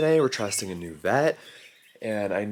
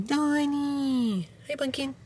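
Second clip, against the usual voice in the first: cooing to dog; 4 dB quieter, slower than usual. Real, not acted. Owner chatting to her dog at the vet, high cooing. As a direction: coo a pet's name in a high singsong.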